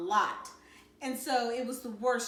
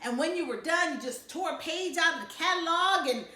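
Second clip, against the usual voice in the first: higher, louder gleeful telling; 7 semitones higher, 6 dB louder than usual. Acted. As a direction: relish the gross detail. Dad building to gross detail.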